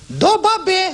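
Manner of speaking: very high squeaky snake, mouth mangled; garbled snake voice. Acted. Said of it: "She looked at him and says".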